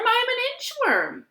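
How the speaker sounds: high little pleading inchworm voice. Acted. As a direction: plead high as a tiny worm. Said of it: "said the inchworm".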